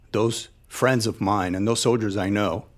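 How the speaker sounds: normal talking voice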